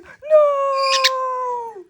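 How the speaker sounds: high, shouted "No!"; mock desperate shout; character's cry of no. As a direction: yell out in panic. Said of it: "He's like".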